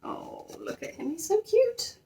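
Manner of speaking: fond cooing aside. Real, not acted. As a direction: coo at him like a sweet pet. Cooing over a picture of the bear.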